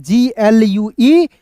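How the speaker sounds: drawn-out letter dictation; slow spelling out. Shown as a demonstration. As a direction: spell जीएलयूई slowly, letter by letter. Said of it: "यूई का उच्चारण". (धीरे-धीरे अक्षर बोलना)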